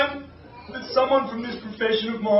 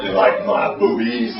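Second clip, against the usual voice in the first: much lower teasing voice; mock taunt; 12 semitones lower than usual. Gladiator to audience, low mocking taunt. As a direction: tease the crowd, low.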